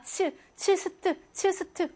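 츠스트 spelled out slowly, syllable by syllable; sounding out 츠스트. Shown as a demonstration. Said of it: "발음이 츠스트". (츠스트를 천천히 높게 또박또박 발음)

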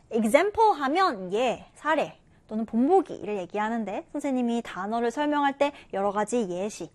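usual speaking voice

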